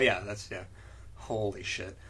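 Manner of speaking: ordinary talk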